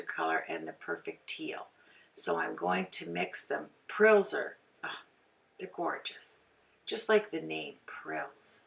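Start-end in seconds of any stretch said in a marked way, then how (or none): none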